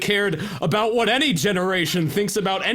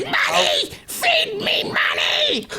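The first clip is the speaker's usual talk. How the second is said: shrill, very high screaming shout, the second line drawn out slowly; screeching fetus; 3 dB louder, slower than usual. Acted. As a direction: shriek for money like a hungry tiny monster.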